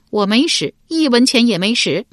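stubborn denial. A narrator's reading. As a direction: deny it stiff-necked, insisting on innocence. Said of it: "硬着脖子回道". (硬着脖子嘴硬否认)

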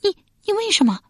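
very high, shocked outcry; wife's alarmed, stammered question; startled cry. A narrator's reading. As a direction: cry out in horror. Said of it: "亚子惊叫一声道".

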